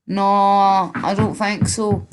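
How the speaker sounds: slow, drawn-out, loud Glaswegian; mock-aggressive Glasgow accent, slow and forceful; thick Glasgow accent. Shown as a demonstration. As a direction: do a thick Glasgow hard man, stretching the words. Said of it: "Thick accent, thick accent, like Glasgow".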